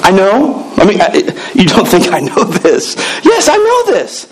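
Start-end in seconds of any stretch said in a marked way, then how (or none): 3.2-4.3 exasperated yes. Retold or imitated. Exasperated retort, very high.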